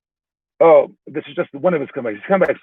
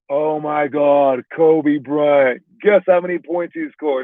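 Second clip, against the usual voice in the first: drawn-out, awestruck retelling; imitating an amazed Lithuanian guy; excited Lithuanian guy; much slower than usual. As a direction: stretch the words out in amazement. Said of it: "He goes".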